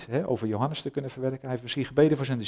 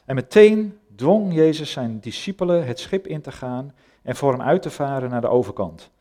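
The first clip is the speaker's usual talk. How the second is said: louder, solemn scripture reading to the congregation; bible reading; 4 dB louder than usual. Acted. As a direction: read the passage out like from the pulpit. Reads Bible passage aloud.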